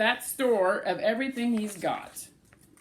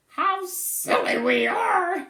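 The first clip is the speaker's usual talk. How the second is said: slow, higher squirrel voice; mild mutter; 8 semitones higher, 4 dB louder, slower than usual. Acted. Cyril admitting their silliness to Bruce. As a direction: say it ruefully, drawn out. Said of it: "he managed to mutter".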